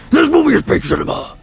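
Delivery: loud, high, excited proclamation; hyped shout. Real, not acted. Vlogger hyping Venom to viewers, loud excited shout. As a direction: shout it like a hype man.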